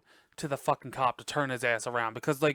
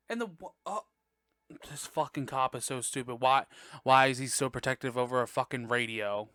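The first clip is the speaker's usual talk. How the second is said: ordinary talk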